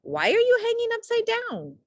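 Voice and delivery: high, loud creature voice; loud peculiar creature; loud strange voice. A narrator's reading. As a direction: boom it out at the dangling bat. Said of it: "a loud voice said".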